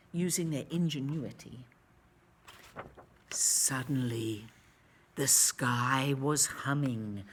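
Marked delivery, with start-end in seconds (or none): none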